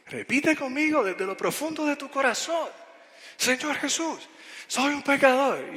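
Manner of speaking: high-pitched, fired-up prayer command; bien bravo. Retold or imitated. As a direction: lead the prayer fiercely as the youngster. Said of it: "bien bravo". (oración mandona, brava y aguda, imitando al jovencito)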